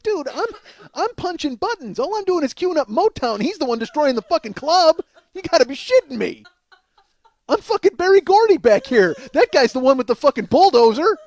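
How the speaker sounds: high-pitched, exasperated rant; hammed-up defence against an unfair telling-off; indignant protest. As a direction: argue back in comic outrage. Retold protest at being yelled at.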